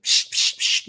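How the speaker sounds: imitated spray puffs, "psh, psh, psh"; quick hissing squirts from the mouth; spray noise. Jovial man spraying the teller, imitated spray noise. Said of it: "he was like".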